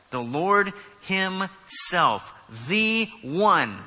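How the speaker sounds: "Lord himself, the one" very slow, each word separately punched; emphatic statement. Real, not acted. Preacher declaring who returns, "the one" loud and emphatic. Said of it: "That is an emphatic statement".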